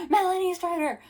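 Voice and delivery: high, loud hammed-up yell; mock screaming. Mocking Jared calling Melanie back, hammed-up screaming. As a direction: parody a man screaming in someone's ear. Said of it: "right in her ear screaming".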